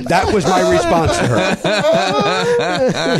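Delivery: high, slow, helpless laughter; laughing at himself. Real, not acted. Laughing at what he told Palin, high and slow. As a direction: laugh in disbelief at yourself. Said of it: "In a panic".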